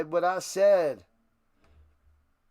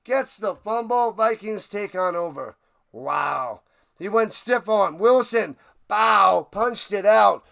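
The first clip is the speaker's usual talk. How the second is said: much louder, higher excited announcer call; play-by-play call; 6 semitones higher, 10 dB louder than usual. Acted. Streamer calls the fumble return live, shouting excitedly. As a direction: yell out the fumble like a broadcaster.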